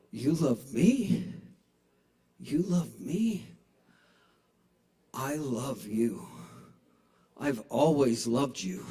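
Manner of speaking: slow, hammed-up romantic confession; mock rom-com. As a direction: drag it out like a movie declaration of love. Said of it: "I decided to go rom-com on her".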